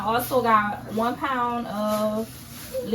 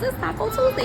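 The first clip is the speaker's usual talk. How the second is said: excited; 13 semitones higher, faster than usual. Acted. Announcing Taco Tuesday.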